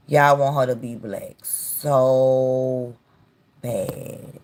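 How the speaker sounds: slowed-down, pointed "so bad"; slow emphatic repeat. Shown as a demonstration. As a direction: spell it out slowly, word by word, to hammer it home.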